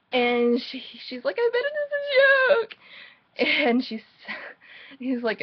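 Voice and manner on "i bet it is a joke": very high, louder mock heroine voice; high-pitched mocking impression of the heroine; mocking squeak. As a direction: squeal it in mockery of her. Mimicking the heroine's reply, mockingly.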